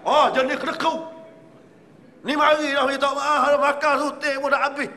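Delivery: loud high scorn at the stingy owner; indignant outburst. Real, not acted. Preacher mocks the stingy owner.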